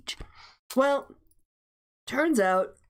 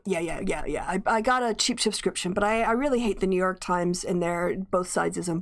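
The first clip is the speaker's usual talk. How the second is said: usual speaking voice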